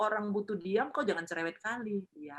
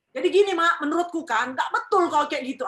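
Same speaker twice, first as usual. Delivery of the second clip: louder and higher, pushy tone to mother; brash, loud lecturing; 7 semitones higher, 9 dB louder than usual. Shown as a demonstration. Child bluntly correcting mother, loud and pushy. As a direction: tell her she's wrong, bluntly. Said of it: "kalau itu dapat tabok kok nanti".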